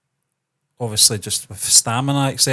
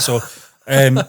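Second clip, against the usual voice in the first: ordinary talk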